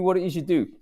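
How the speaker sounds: ordinary delivery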